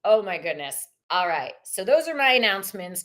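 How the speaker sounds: usual speaking voice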